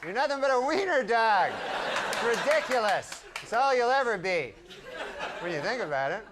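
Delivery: high mocking yell at a tiny dog; scolding the wiener dog. Owner waking up, scolding his wiener dog in a high sneer.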